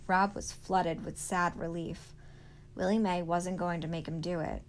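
ordinary delivery, nothing marked

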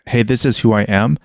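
usual speaking voice

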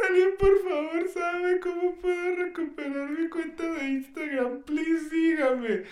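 high-pitched tearful wail, drawn out; hammed-up weepy begging; mock crying plea. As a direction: imitate a sobbing plea, whiny and stretched. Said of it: "estaba llorando, güey, así de".

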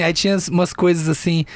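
ordinary delivery

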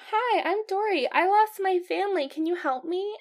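put-on high, innocent child fish voice; lost little Dory. Lost fish asking strangers for help, innocent pleading. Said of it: "it's, like, so innocent".

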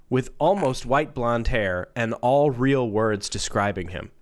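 ordinary talk

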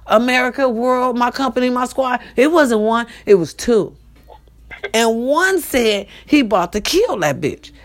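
ordinary talk, nothing marked

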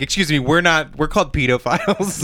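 imitated indignant objector, insisting on the word; put-on affronted correction; mock-offended correction. Imagined pedophiles correcting people, mock-offended. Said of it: "what they're saying is like".

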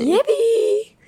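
high loud triumphant yay; proud of faking sick, gleeful; gleeful cheer. Real, not acted. Gleeful cheer after being called sly.

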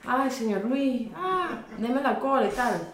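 coqueta y aguda, lenta, llamando al hombre; seductora Sayona. Acted. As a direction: pide la cola con voz melosa. Sayona voiced luring men. (Sayona coqueteando para que paren)

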